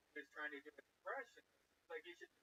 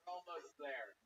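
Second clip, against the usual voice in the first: ordinary delivery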